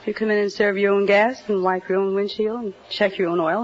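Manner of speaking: ordinary delivery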